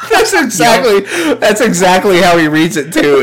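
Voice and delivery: high, loud laughing words; real laughter in the voice; talking through laughter. Real, not acted. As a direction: squeeze the words out while cracking up. Still laughing after impression.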